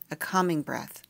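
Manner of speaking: plain reading voice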